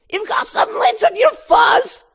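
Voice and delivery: high, loud nagging mom shout; scolding mother. Acted. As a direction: scold him for skipping the stairs. Mother fussing over Barry.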